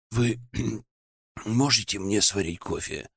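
barely awake, croaky; hoarse sleepy voice. Acted. Sleepy guest asks for coffee.

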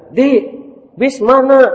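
put-on high, loud lady's voice asking the boy; rich mother asking. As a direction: lean out of the car and ask. Mother in car asks boy.

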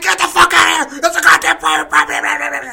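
high, loud furious yell; screaming. Retold or imitated. Actor screaming at the Manson kids: high loud furious yell. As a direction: scream them off the property. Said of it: "He's screaming at him".

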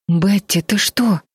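ordinary delivery

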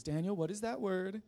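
a hint of a stern classroom question; questioning teacher. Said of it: "And then she says".

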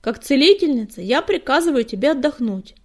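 loud, firm order; resolute. A narrator's reading. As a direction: lay down the law as healer. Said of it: "решительно заявила она".

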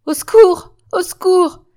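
high, loud scream for help; ringing, desperate call to anyone who can hear; desperate cry. Acted. Germaine, trapped in an ambush, screams desperately for help. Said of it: "appel vibrant et désespéré".

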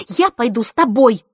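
high, loud, adamant declaration; insist, allowing no argument; unyielding. A narrator's reading. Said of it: "непреклонно заявила Рита". (непреклонно, высоко и громко)

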